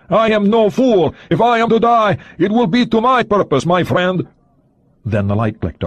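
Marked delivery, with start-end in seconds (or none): 0.1-4.3 cornered Soviet agent sneering defiance. A narrator's reading. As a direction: sneer it back, pitched high. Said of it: "the voice sneered, quavering".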